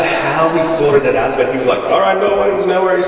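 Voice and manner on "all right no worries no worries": higher, louder mock Indian accent; accidental Indian accent, pitched up and loud. Neighbour shrugging off missing tea, in an Indian accent. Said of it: "I don't know why he's Indian".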